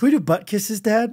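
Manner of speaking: put-on slightly high, innocent kid question; a hint of a child's voice; little boy imitation. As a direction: lightly mimic a small son asking dad. Jokes in son's voice.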